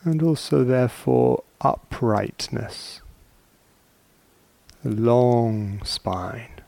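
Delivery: slow, soft words with long silences between; calm, spacious meditation guidance; guided meditation. Acted. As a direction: ease listeners into stillness, one unhurried phrase at a time.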